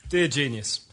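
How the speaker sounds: ordinary delivery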